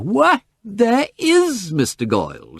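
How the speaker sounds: high, slow cry of pleasure; delighted cry. Acted. Miss Tarrant spotting Goyle across the lounge, delighted cry. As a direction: call out his name with glee. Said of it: "she cried".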